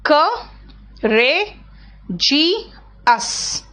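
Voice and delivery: क, रे, जी, अस split into four separate beats; syllable by syllable. Shown as a demonstration. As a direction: break करेजियस into क, रे, जी, अस with a pause between each. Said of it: "करेजियस बोल के देखो तो".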